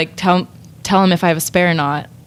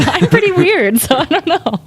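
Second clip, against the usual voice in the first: laughing; 10 semitones higher, 3 dB louder than usual. Real, not acted. Laughing at herself.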